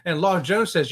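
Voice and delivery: usual speaking voice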